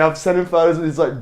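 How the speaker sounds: ordinary delivery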